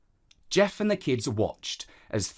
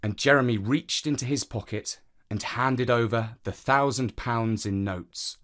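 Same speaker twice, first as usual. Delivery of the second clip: usual speaking voice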